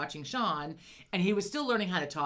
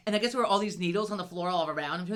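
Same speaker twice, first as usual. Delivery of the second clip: usual speaking voice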